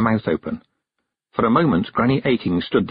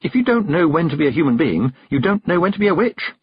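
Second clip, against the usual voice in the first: lightly voiced young witch, pitched higher; earnest girl stating a maxim, rising at the end; a hint of a bold girl answering back; 8 semitones higher than usual.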